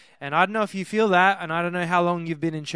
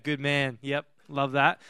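normal talking voice

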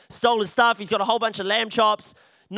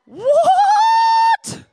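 sudden shriek, much higher and louder; shocked what; 7 dB louder than usual. Acted. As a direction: shriek it in disbelief. Job's shocked reaction.

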